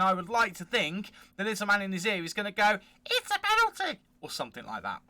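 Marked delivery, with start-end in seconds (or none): 3.1-4.0 very high, strained put-on voice; high falsetto mock official; squeaky VAR voice. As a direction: squeal it like the little man in the ref's ear. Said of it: "All that hurt my throat. Remind me never to do that voice again".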